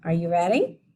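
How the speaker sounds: normal talking voice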